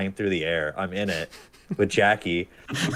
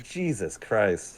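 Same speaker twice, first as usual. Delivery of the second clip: exasperated; 4 dB quieter, much slower than usual. Retold or imitated. Reacting to the bug.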